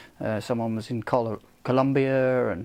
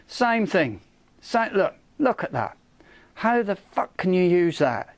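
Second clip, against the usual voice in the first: raised, exasperated rant with higher pitch; real frustration boiling over, pitched up; getting upset; 6 semitones higher than usual. Real, not acted. Ranting on camera about a snapped light bracket, real exasperation. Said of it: "Well, I am getting upset".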